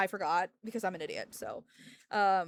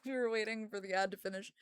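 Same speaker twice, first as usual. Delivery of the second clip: ordinary talk, nothing marked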